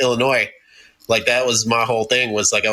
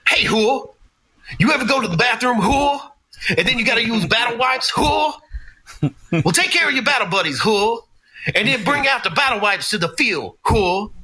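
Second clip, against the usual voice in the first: drill sergeant impression; 10 semitones higher than usual. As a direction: bark orders like a hammed-up drill instructor. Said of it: "And he taught like this".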